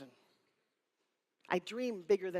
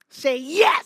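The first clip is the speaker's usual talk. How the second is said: much louder, rising "yes", pulling an answer from the crowd; loud crowd call; 4 semitones higher, 13 dB louder than usual. Shown as a demonstration. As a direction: shout "Say yes" up at the audience.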